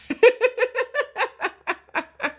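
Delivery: bursts into a high, delighted laugh; real high-pitched laugh. Real, not acted. Laughing at chat messages.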